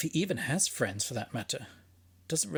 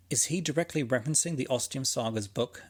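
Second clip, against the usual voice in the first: ordinary talk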